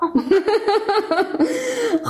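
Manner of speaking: real laugh, high and breathy. Real, not acted. Host laughing at the caller's joke.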